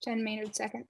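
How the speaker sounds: tired voice. Real, not acted. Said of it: "That's my tired voice".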